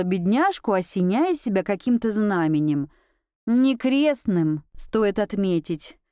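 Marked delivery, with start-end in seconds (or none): none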